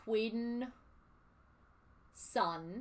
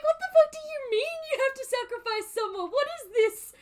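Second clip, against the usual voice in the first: much higher and louder, outraged disbelief; shocked yell; 13 semitones higher, 7 dB louder than usual. Retold or imitated. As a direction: shout it like you can't believe what you're hearing. Retelling her shocked reaction to sacrifice.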